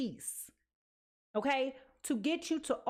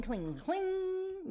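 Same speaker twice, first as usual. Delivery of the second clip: playful sing-song; 7 semitones higher than usual. Pretend. Playful echo of the word.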